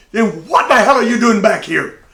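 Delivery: the bully who thought he was rid of him; irritated mock yell. Tank annoyed at the return.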